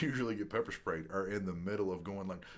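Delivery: ordinary talk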